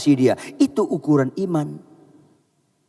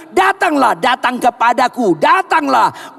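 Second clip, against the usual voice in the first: "Datanglah" called high and loud; high, loud repeated "Datanglah"; stress on datanglah; 9 semitones higher, 3 dB louder than usual. Shown as a demonstration. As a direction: make "Datanglah" the clear point. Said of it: "Bukan penekannya berjalan di atas airnya".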